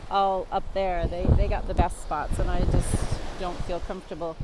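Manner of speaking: loud voice over the roaring wind; shouting over wind. Real, not acted. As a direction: project loudly over the wind. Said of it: "the wind is so strong here".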